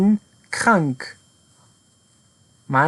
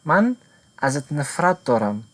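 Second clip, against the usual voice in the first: ordinary talk, nothing marked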